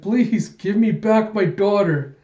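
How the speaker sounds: high, slow begging; imitated crying plea. Crying father begs for his daughter, acted high plea. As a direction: plead slowly in a mock sob. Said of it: "he's just crying".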